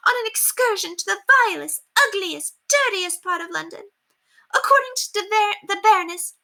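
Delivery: high, scornful society lady; appalled mistress reading the card; disgusted haughty lady. Acted. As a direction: rant in disdain. Lady Constance complains in disgust.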